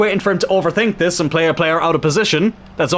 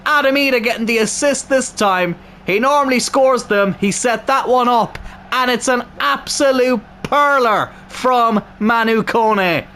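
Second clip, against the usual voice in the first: normal talking voice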